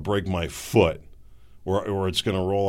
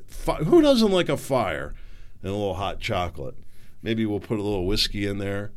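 ordinary talk

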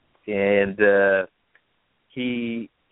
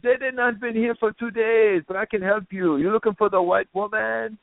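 put-on Jamaican accent; 12 semitones higher than usual. Retelling the bar's Jamaican guy offering help, Jamaican accent. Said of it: "there's this Jamaican guy there".

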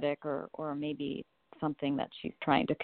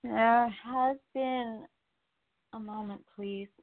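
slow, solemn channeled voice, pitched higher than her own; unhurried spirit-channel voice lifting into the channel; channeling angels; 6 semitones higher than usual. Acted. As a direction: speak as the angels coming through a medium.

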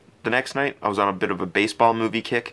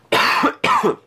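chesty cough; 5 dB louder than usual. Real, not acted. Coughing again mid-story.

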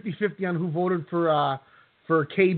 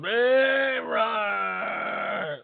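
big drawn-out announcer shout; intro announcer; 4 semitones higher than usual. Retold or imitated. Host imitating the guy who does the intro voice, drawn-out announcer call. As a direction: holler it like the show's intro voice. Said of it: "the guy that goes".

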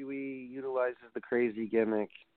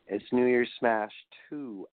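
usual speaking voice

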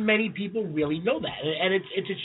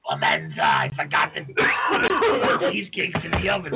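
mobster voice; 4 semitones lower than usual. Retold or imitated. Mock Godfather mobster line.

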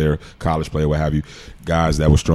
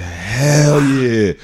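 higher, drawn-out excited answer; mounting excitement, retold; excited reply; 6 semitones higher than usual. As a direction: stretch out an eager yes.

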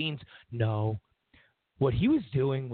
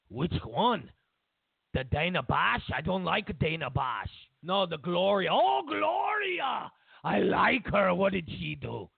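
hammed-up Russian accent, swooning on "Oh, Gloria"; picky, then delighted, in comic Russian accent; Vladimir Putin; slower than usual. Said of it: "you don't do a good Russian accent".

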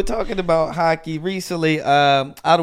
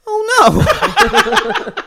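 very high, louder, laughing mock groan; son mimicking groan; 5 dB louder than usual. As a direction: ham up a kid copying a stranger's groan. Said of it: "he starts mimicking the guy".

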